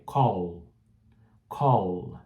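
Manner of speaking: "Call" with the tongue on the roof of the mouth at the end; final L sounded. Shown as a demonstration. Teacher modelling the final L in "Call". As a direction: land "Call" on a firm L. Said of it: "língua no céu da boca".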